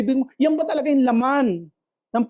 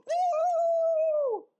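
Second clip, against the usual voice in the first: falsetto cheering woo, much higher; high fan cheer; 6 dB quieter than usual. Retold or imitated. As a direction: cheer like a fan when the player scores. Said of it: "nakashoot yung paborito mong player". (matinis na sigaw ng fan)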